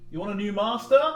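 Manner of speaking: loud booming shout across the field; magically amplified voice. Acted. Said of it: "scream out".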